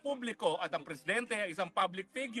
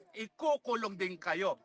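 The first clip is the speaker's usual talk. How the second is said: ordinary talk, nothing marked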